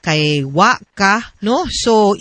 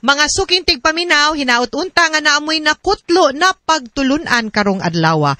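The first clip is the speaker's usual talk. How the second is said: bright, raised announcer outro over music; radio sign-off; 5 semitones higher than usual. Acted. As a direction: close the show like a radio host thanking listeners. Radio hosts signing off programme.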